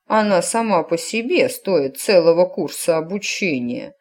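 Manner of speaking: plain reading voice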